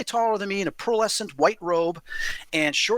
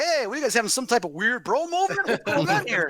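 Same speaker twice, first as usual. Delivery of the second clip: lightly voiced baffled guys, higher pitch; a touch of mock bewilderment, pitched up; puzzled onlookers; 5 semitones higher than usual. Guys at the table question two hugging men, mildly mock-baffled.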